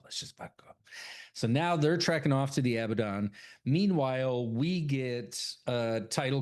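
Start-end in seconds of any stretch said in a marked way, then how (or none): 0.0-0.7 whispered, voiceless. Retold or imitated. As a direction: hiss it under your breath to get out. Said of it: "She don't want people to know".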